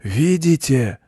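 self-important. A narrator's reading. Goat makes his point to the children, self-importantly. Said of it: "важно сказал Козёл".

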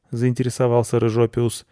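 usual speaking voice

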